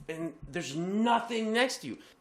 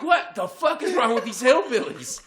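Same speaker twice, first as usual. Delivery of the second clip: loud, high, incredulous complaint; hammed-up indignant yell; exasperated outrage; 6 semitones higher, 5 dB louder than usual. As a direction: rant at reckless drivers in disbelief. Acting out outrage at speeders.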